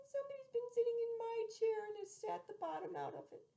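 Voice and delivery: put-on high squeaky baby bear; little wee bear voice. Baby bear finding her empty bowl, high wee voice. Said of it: "in her little, small, wee voice".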